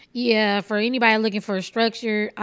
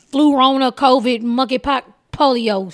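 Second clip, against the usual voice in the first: emphatic listing; 7 dB louder than usual. Real, not acted.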